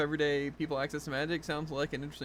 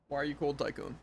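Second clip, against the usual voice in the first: usual speaking voice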